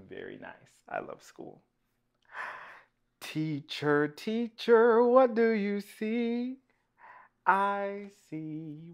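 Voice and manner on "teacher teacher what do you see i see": chanted refrain, leaping high and loud on the repeat; sing-song story reading. Acted. Reader to children with picture book, sing-song chant. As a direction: lilt it musically for little listeners.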